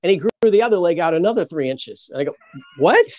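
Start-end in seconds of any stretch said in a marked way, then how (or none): 2.8-3.1 high, louder disbelieving "what?"; acted stunned reaction; retold astonishment. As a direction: blurt it out in amazement, pitched up. Retells his own astonished reaction.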